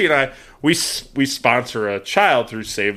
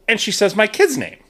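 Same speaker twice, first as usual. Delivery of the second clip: normal talking voice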